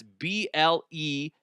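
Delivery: spelled out slowly. Shown as a demonstration. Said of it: "use promo code bleav".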